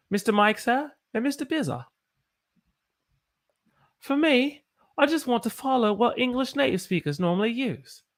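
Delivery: high, put-on British accent. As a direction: read it out in a high-pitched British voice. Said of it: "in my British accent".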